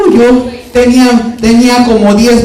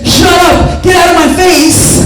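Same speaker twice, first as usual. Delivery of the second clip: higher shouted brush-off; angry yell; 6 semitones higher, slower than usual. Retold or imitated. Wounded youth shouting others away, angry yell. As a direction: snap it at them, walled off.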